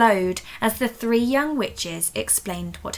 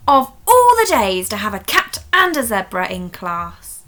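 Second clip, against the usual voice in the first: louder, fed-up scolding; a cross teacher at the end of her rope; exasperated teacher; 9 dB louder than usual. Acted. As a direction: let the frustration out.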